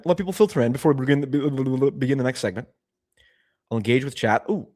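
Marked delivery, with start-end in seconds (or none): none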